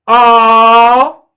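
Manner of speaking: loud, drawn-out taunting oh; gleeful mockery. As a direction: crow over her loudly. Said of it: "I fell out the chair".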